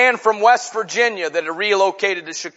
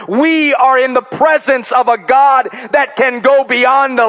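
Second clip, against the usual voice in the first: raised, driving preacher's voice; preaching at full force; 7 semitones higher, 5 dB louder than usual. Acted. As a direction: preach it out to the whole church. Preacher exhorting the congregation.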